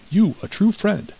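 high, slow, reproachful; indignant accusation. A narrator's reading. Mouse accuses the treacherous cat.

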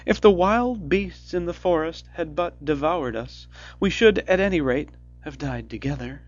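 lamenting. A narrator's reading. Gretel lamenting in despair.

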